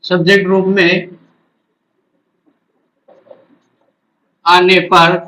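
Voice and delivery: slow chunks with long pauses; dictating while writing. Real, not acted. Teacher writes out the rule for students, slow dictation. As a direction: dictate phrase by phrase, waiting after each.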